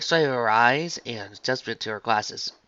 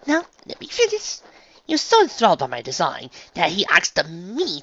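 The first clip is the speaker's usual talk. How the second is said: higher-pitched, ladylike and eager; Rarity, refined unicorn; 8 semitones higher, 5 dB louder than usual. Acted. Dressmaker tells friend of an enthralled customer, refined eagerness. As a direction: plead and explain with refined flourish. Voices Rarity explaining her need.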